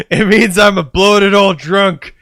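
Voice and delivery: hammed-up drunk cop bravado; silly character voice. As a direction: brag like a washed-up star. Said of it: "I don't know why I'm doing this voice".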